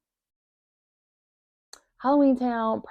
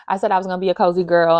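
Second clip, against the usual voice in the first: ordinary talk, nothing marked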